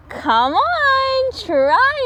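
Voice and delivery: high coaxing call. A narrator's reading. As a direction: urge your friend to join. Squirrel calling to friend.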